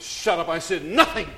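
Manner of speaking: loud protest; defensive shout. Retold or imitated. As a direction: shout back in panic. Defending himself in retold scene.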